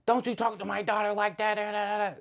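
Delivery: high-pitched imitation of a scolding mother; put-on high nagging tirade; mock angry rant. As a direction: mimic her going off, trailing into nonsense syllables. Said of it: "Start just trying to spaz on me".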